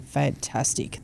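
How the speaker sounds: a soft whispered fantastic; a whispered thrill at a party invite; whispered delight. Retold or imitated. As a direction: breathe it with private glee. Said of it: "I was like".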